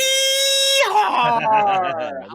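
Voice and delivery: high shrieking laugh; loud burst of laughter. Real, not acted. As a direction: crack up loudly. Laughs at the cowboy yeehaw.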